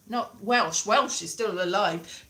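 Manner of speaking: ordinary delivery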